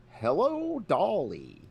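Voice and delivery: sudden high loud Hello; dad picking up the landline, high and loud; impression of dad answering. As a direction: squeak out the greeting like an old man. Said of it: "he's like".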